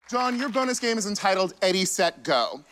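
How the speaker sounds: high, bright host read; game show host. Acted. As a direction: announce the bonus game like a TV host. Game show host reading the bonus game.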